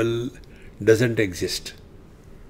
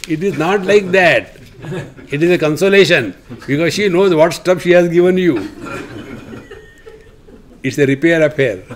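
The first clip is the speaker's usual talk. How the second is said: laughing through the joke; 3 semitones higher than usual. Real, not acted. Joke about mothers-in-law.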